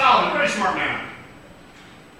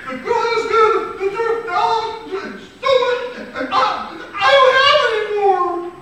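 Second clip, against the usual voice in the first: put-on upset girl; 5 dB louder than usual. Distraught girl explains.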